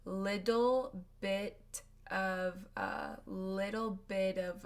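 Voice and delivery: Little bit of said slowly, T flapped like D; slow flap-T model. Shown as a demonstration. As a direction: drag out Little bit of with a D sound. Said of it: "It sounds like a D".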